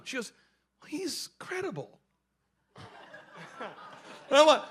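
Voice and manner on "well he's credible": soft, higher-pitched imitated answer; sheepish reply. Coworker explains trusting the doctor, sheepishly. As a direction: offer it gingerly, pitched up.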